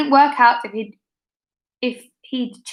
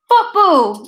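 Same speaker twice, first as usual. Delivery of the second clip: a higher, louder shout from a pupil; yelled; 6 semitones higher, 5 dB louder than usual. A narrator's reading. A pupil yelling a suggestion: higher, louder shout. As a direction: call it out from the crowd. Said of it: "someone yelled".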